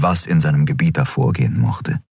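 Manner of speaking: plain reading voice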